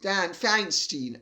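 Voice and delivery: Feinstein settled as FINE-steen; Feinstein ending in "steen". Shown as a demonstration. Commentator settling on the senator's name, Feinstein as FINE-steen. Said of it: "I think it's how it's pronounced".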